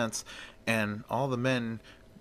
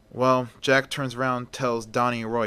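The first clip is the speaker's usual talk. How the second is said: plain reading voice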